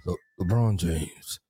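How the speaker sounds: low, slow, deflated away-player call; bored announcer. Retold or imitated. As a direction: announce the visitor with no joy. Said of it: "and then LeBron score".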